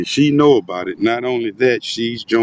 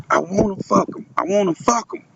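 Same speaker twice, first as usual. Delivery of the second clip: high voiced quote; 8 semitones higher than usual. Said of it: "That's what she said".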